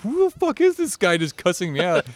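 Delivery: high, indignant retold outrage; mock pissed-off. Kid baffled by a stranger cussing him out, high mock indignation. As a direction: act out baffled anger in a high voice. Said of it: "I was fucking getting all pissed off".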